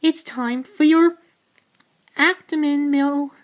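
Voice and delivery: high, sing-song mother calling her son; doting mum voice. Retold or imitated. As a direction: coo at him like a fussy mum. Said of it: "Milhouse's mum's like".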